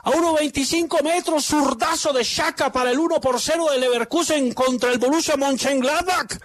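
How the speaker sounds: high, slow, soaring play-by-play; goal commentary. Acted. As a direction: call the goal like a radio announcer.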